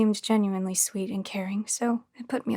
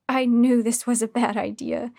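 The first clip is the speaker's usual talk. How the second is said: plain reading voice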